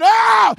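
very loud, high "oh" holler; mock wail at full volume; imitated holler. Believer hollering for God to grab the bar, imitated holler. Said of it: "But you hollering".

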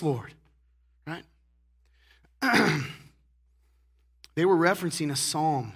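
ordinary delivery

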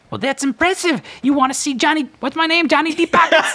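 very high, squeaky hustler voice. Acted. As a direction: play a slick, grinning money changer. Money changer boasts.

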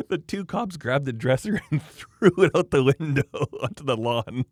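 high, amused telling broken by laughs; laughing while talking. Real, not acted. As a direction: laugh through the story.

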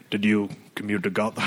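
lightly imitated Irish villain; a hint of watered-down Irish brogue; Liam Neeson's Irish accent. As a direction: attempt a restrained Irish-American accent. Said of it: "I can't do the fucking accent".

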